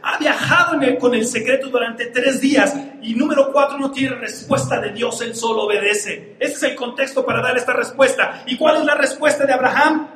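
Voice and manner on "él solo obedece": ordinary delivery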